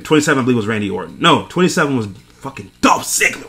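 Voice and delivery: ordinary talk, nothing marked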